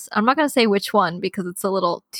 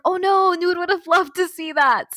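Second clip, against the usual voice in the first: much higher, lightly hammed regret; mock dismay; 9 semitones higher than usual. Woman retells regretting friend missed the show, mock dismay. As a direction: play up the disappointment. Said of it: "I was like".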